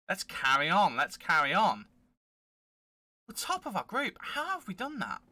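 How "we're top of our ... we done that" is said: high, hushed astonishment; softly squeaked real amazement; amazed disbelief. Real, not acted. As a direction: marvel that it happened at all. Amazed at topping the group.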